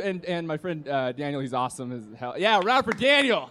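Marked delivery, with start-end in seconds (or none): 2.4-3.5 high-pitched call for applause; rallying cheer. Real, not acted. Comic asking the room to applaud a friend, cheering. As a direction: whip up the crowd.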